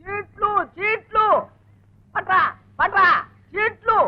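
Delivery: high, repeated call with pauses; insistent calling. Acted. Husband calls to hard-of-hearing wife for the slips, insistent and high. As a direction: call out for something to be fetched.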